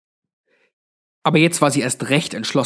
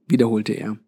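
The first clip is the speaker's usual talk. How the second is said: usual speaking voice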